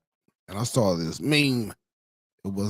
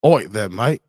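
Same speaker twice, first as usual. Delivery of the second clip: mock British accent, pitched up, punchy; 3 semitones higher, 5 dB louder than usual. Streamer mimicking British people getting stabbed, mock British accent. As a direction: ham up a put-out British accent. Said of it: "British people getting stabbed be like".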